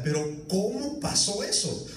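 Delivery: a fan who can't believe the late goal; stunned disbelief. Retold or imitated. Fan watching rival score late, slow stunned disbelief. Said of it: "usted se queda".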